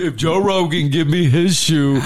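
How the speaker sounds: emphatic mock bargaining; Derek Lewis impression. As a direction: bargain as the fighter, putting on his voice. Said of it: "if Lewis was like".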